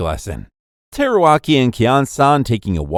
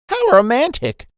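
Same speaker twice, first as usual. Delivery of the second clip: high, delighted little cheer; lightly voiced old lady, pitched way up; 13 semitones higher than usual. A narrator's reading. Old lady cheering the couple, high delighted cry. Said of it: "an old lady cheering".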